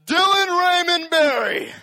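angry parent shouting the full name, high, loud and slow; parent yelling full name. Retold or imitated. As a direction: bellow the kid's full name like a furious mom. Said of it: "have your parents ever said something like".

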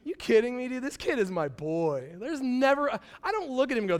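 usual speaking voice

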